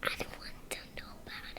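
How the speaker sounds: quick secretive whisper. Real, not acted. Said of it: "why are you whispering".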